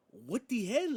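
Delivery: high, mock Spanish-accented bafflement; Penta confused. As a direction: imitate a confused wrestler in a bad Spanish accent. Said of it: "he confused and shit".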